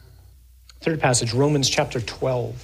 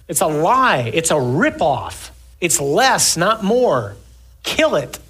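fervent preaching; 5 semitones higher than usual. Acted. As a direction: preach it hard to the congregation. Preaching at full force.

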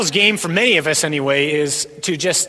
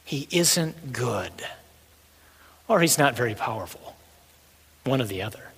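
devil's lying voice; 3 semitones higher than usual. Acted. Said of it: "That's his lie".

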